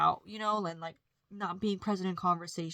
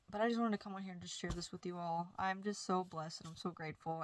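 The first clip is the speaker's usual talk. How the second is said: ordinary delivery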